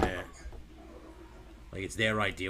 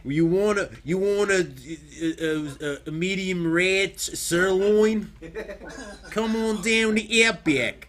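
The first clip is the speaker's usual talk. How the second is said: high, slow, cartoonish Australian; Outback Australian accent; 5 semitones higher, slower than usual. Shown as a demonstration. Parodying Outback Steakhouse ads, exaggerated Australian accent. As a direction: stretch out a steakhouse-ad Aussie pitch. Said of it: "racist Australian accent from Outback Steakhouse".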